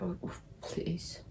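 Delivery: breathy whisper of dread. Retold or imitated. Imagining catching covid, whispering in dread. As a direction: whisper it like a quiet plea.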